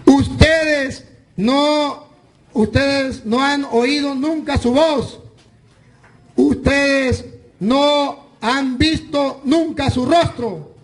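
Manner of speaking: slow, weighty scripture reading, word by word; slow emphatic reading. Real, not acted. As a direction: lay each word down slowly. Reading the key verse slowly.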